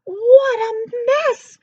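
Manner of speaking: high, slow, loud exclaim; princess crying out. Acted. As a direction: squeal in disgust. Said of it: "cried Princess Peapod".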